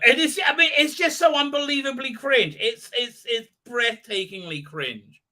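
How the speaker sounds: high, incredulous rant easing down; exasperated disbelief. Real, not acted. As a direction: let the pitch leap with exasperated disbelief, then settle. Reacting to cringeworthy show.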